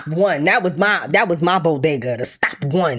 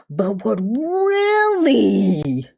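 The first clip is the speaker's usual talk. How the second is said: drawn out; 2 semitones higher, much slower than usual. Real, not acted. Drawn-out build-up.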